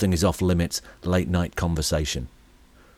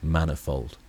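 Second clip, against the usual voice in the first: "Manifold" lower and softer, seductive; sexy; 4 semitones lower, 5 dB quieter than usual. Shown as a demonstration. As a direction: breathe "Manifold" in a sultry tone. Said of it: "Here, I'll say it again".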